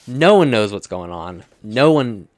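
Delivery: loud, drawn-out mock lament; exasperated outburst. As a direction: belt out the confusion for comic effect. Mock despair at plot confusion.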